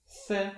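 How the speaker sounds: "se" said like English th in think; Castilian c demo; lisped c. Shown as a demonstration. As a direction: model the lisped th. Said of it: "wymawia się jak se".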